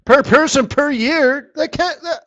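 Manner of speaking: high, loud disbelief cracking up; incredulous. Real, not acted. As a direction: squeal of disbelief. Disbelief at tiny number.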